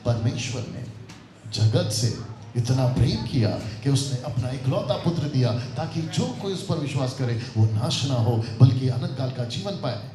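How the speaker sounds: low, measured scripture reading; soft reading. Shown as a demonstration. Preacher showing how John should be read, low and soft. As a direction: recite it low and unforced. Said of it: "कैसे पढ़ते हैं".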